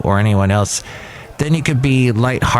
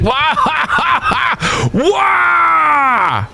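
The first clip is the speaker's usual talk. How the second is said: mock crybaby wail. Mocking the coworker as a crying baby, fake wail.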